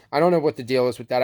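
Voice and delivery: ordinary talk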